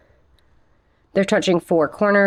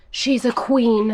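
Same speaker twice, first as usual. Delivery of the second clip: gushing praise; 4 semitones higher, slower than usual. Real, not acted. Gushing over plant.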